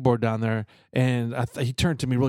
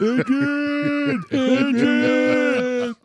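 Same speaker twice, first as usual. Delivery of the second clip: loud, high, hoarse "Adrian!" cry; Rocky Balboa yell; 11 semitones higher, 4 dB louder, much slower than usual. Retold or imitated. Doing Rocky calling for Adrian, hammed-up loud yell. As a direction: belt it out like Rocky after the fight. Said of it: "Kinda sound like Rocky Balboa".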